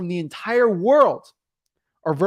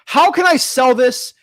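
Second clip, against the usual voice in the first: lightly acted guru question, higher and louder; mock guru wondering aloud, pitched up; imitated eager guru; 8 semitones higher, 7 dB louder, slower than usual. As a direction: punch it up slowly like an eager guru. Voicing a scheming guru's question.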